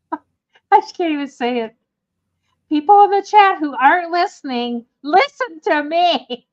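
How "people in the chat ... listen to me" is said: calling out. Real, not acted. Calls out to chat viewers.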